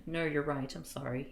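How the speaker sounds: soft, low murmur; murmured apology. A narrator's reading. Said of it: "he murmured".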